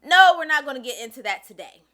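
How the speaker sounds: loud cutoff. Real, not acted. Creator cutting off the clip to the audience, loud firm interruption. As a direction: shut the topic down hard.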